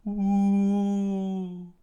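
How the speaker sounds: long, high, loud "ooh" of wind; howling wind laugh. Acted. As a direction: imitate a wind howling with glee. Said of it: "the wind laughed".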